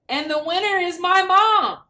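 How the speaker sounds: put-on high, loud, thrilled child shouting; excited cheer. Child cheering that mom won the election, excited shout. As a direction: burst out with joy at mom's win.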